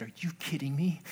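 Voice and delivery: hushed, incredulous question; softly stunned, as if it can't be true; stunned disbelief. Retold or imitated. Preacher recalling first grasping a verse, stunned and hushed. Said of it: "I lost my mind".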